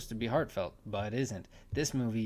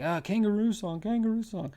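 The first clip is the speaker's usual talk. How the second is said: high-pitched mock child voice; imitation of an insistent little kid; pestering kid; 10 semitones higher, 5 dB louder than usual. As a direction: nag like a kid begging, loud and high. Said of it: "the kid's bugging him about something".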